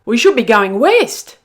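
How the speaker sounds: loud anxious cry; a dingo pup crying out he's lost; cried out. Acted. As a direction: shout it out in alarm. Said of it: "he cried".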